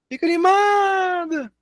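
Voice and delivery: animado dito muito mais agudo e alongado; bem agudo. Shown as a demonstration. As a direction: fale animado num tom alto e cantado. (fica animado em tom bem agudo)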